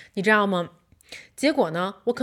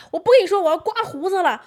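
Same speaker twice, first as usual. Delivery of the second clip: higher, fast, huffy retold retort; imitating husband angry; 8 semitones higher, faster than usual. Husband cutting off the quarrel, huffy and fast. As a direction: storm off mid-fight, mimicking him. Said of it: "我们俩就在那儿狂吵".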